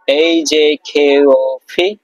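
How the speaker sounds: each letter drawn out slowly and clearly; slow letter drill. Shown as a demonstration. Teacher reciting the letters slowly to students, drawn out. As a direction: spell out the letters slowly for the class. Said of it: "আবার একটু repeat করি".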